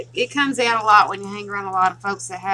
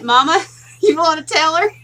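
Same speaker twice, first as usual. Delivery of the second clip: laughing; 7 semitones higher, 9 dB louder than usual. Real, not acted. Asks mama while laughing.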